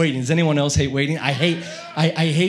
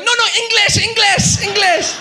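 high, loud repeated shout; hammed-up exasperated yelling; frantic interrupting; 11 dB louder than usual. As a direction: cut the caller off, shouting. Cutting off driver on the phone.

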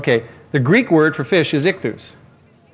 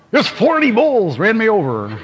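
higher, slow, laughing tall-tale brag; boastful drunk; 7 semitones higher than usual. Retold or imitated. Mocking a drunk guest's tall tale, slow laughing brag. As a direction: ham up a drunk's bragging.

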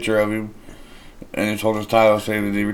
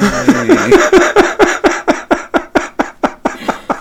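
loud squealing laughter; high shrieking laugh; 9 dB louder than usual. Real, not acted. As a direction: burst into a shrill cackle. S1 reacts to rude son story.

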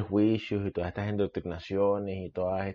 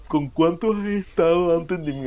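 mocking jealous partner; 9 semitones higher than usual. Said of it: "esa pregunta es ultra tóxica".

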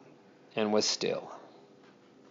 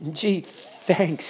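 soft, shivering with cold; teeth chattering; 5 dB quieter than usual. A narrator's reading. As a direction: speak through chattering teeth. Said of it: "teeth were chattering".

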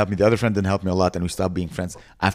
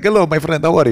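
put-on Middle Eastern accent; 7 semitones higher, 5 dB louder than usual. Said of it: "Israeli accent and Palestinian accent".

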